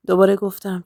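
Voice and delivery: usual speaking voice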